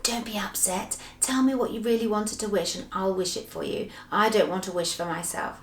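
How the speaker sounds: soft, hushed reassurance; whispered comfort. Acted. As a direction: comfort someone in a near whisper. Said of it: "Moonface whispered to her".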